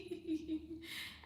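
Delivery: high, soft giggling; real giggle. Real, not acted. Host still amused by a viewer's comment, giggling. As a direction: trail off in high, quiet titters.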